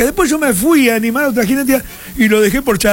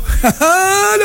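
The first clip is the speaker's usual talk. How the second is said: short high laugh; 8 semitones higher than usual. Real, not acted. Laughs at his own joke.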